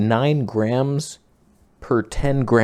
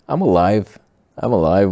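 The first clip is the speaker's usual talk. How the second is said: excited; 7 dB louder than usual. Real, not acted. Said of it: "oximeter reading, relief".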